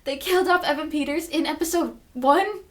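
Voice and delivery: outraged fan. Real, not acted. Fan ranting about the show killing off Evan Peters, indignant. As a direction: protest like a betrayed fan.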